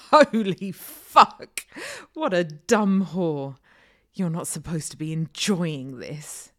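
sudden loud harsh outburst; loud crude shout. Acted. Man shouts at her during sex.

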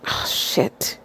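whispering under the breath; whispered curse. Real, not acted. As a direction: breathe it out quietly. Mutters after dropping something.

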